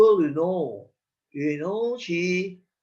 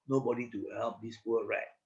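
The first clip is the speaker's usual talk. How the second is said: plain reading voice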